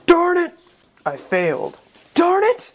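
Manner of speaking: high, annoyed cartoon cry; high frustrated squeak. Failed hero, frustrated.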